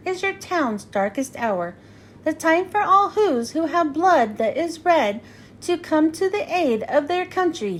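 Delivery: plain reading voice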